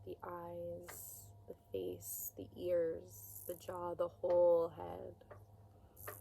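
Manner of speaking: hushed, unhurried meditation guidance; guided body scan. Acted. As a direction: ease them through it quietly, pausing between phrases.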